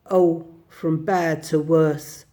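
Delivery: slow, low, heavy-hearted; grave. Acted. Rat telling Badger about Toad, gravely. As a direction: shake your head over a hopeless friend. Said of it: "said the rat gravely".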